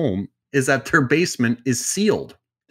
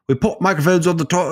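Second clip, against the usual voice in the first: shaky attempt at a Welsh accent; 7 semitones higher, 4 dB louder, faster than usual. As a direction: put on a rough Welsh accent. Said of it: "was that a good Welsh accent?".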